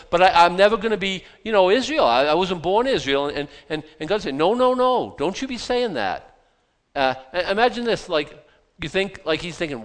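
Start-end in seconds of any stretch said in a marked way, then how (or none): 4.3-6.4 God rebuking. Acted. God answering the preacher's self-pity, higher and firm. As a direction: cut him off like a loving father.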